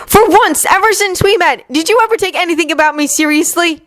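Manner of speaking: high, pleading angry cry; hurt accusation. Acted. Yuri confronts Victor about the kiss, angry and hurt. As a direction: throw the accusation at him.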